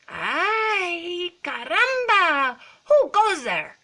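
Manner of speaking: high, loud drawn-out exclamation; challenging kitten hero. A narrator's reading. Skippy meets a band of Chihuahuas, loud dramatic challenge. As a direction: challenge strangers with play Spanish flair. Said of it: "using his very best Spanish accent".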